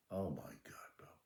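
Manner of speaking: low, whispered dread; quietly stunned, barely voiced; hushed dismay. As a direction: let it out under the breath, appalled. [name]'s dismay at the mistake.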